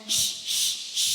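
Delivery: slow supported hissing pulses; slow shh pulses. Shown as a demonstration. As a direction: lift the diaphragm and hiss. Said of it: "Raising that diaphragm up".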